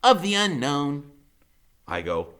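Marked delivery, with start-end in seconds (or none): 0.0-1.1 high, loud lofty lecturer; lofty Freak. A narrator's reading. Freak explaining dragons, high loud lofty voice. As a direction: pronounce it like a scholar, high and loud. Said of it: "Freak says".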